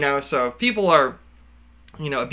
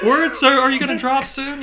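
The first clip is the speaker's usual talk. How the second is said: high mocking doctor voice; 10 semitones higher, 4 dB louder than usual.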